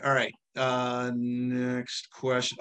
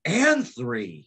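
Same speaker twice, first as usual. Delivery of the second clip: mock amazement; 8 semitones higher, 3 dB louder than usual. Real, not acted. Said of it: "echoing in mock awe".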